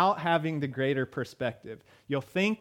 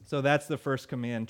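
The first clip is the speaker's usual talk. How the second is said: normal talking voice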